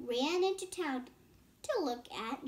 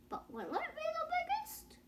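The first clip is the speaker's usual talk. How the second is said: much higher, put-on voice for the uncle; uncle's doubtful question; 4 dB quieter than usual. Child voicing the doubtful uncle in the story, in a high put-on voice. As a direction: lift way up and wonder if it wins.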